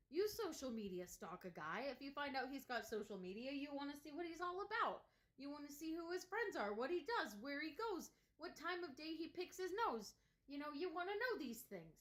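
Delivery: high, animated list; excited rant. Real, not acted. Rising rant to female viewers.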